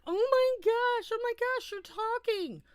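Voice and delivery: frantic family. Retold or imitated. Family to the silent boy who just spoke, frantic. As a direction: gush in amazement as the whole family.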